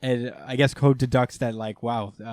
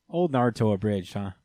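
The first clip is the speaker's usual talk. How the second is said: ordinary talk, nothing marked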